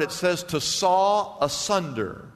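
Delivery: ordinary talk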